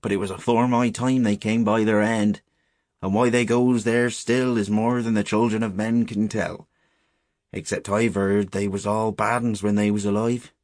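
put-on old countryman passing on dark local lore; grave old shepherd. Shepherd explaining the dead to boys, grave.

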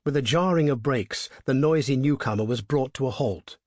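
ordinary delivery, nothing marked

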